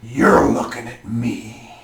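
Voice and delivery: slow, low menacing line; the brute stares down the player; brute's challenge. Acted. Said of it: "he looks at you and he says".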